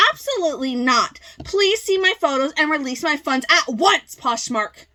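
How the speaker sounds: high, loud outraged tirade; irate seller. Acted. Seller accusing the buyer to Poshmark, irate. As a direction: rant at full pitch, hammed-up fury.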